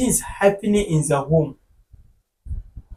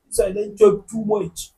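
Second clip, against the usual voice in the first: usual speaking voice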